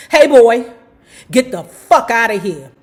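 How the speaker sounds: loud barked southern threat; vicious southern drawl. Retold or imitated. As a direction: snarl it at him like a bigot cop. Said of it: "he said in a vicious southern drawl".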